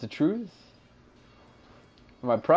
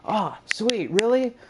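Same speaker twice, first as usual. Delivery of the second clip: mock surprise; 9 semitones higher than usual. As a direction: pitch jumps up high, mock amazed. Mock excited reaction, retold.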